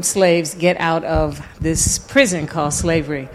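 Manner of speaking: ordinary talk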